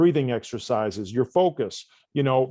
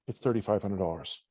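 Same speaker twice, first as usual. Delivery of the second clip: low, soft, unconfident price quote; voice trailing off; 6 semitones lower, 6 dB quieter than usual. Shown as a demonstration. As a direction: let the price sink away without conviction. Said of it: "if your voice trailed off".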